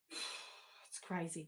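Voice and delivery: slow, breathy sigh of disbelief. Real, not acted. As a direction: let it out on a tired sigh. Sighing at doll prices.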